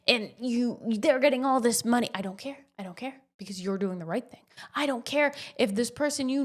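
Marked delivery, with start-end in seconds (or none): none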